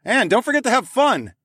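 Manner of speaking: high, loud cheer over the gale; shouting over wind. A narrator's reading. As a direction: yell it out through the storm. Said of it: "I shouted over the wind".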